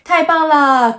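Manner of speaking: 太棒了 high and loud, 了 trailing as an ending; exclamation. Shown as a demonstration. Teacher giving an example of praise, exclaiming. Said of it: "end a question or exclamation".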